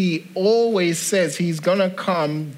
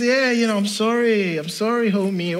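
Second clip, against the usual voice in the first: higher-pitched attempt at a Mexican American accent; 6 semitones higher than usual. Gardener saying sorry for turning up late, imitated Mexican American accent. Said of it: "my best kind of Mexican American accent".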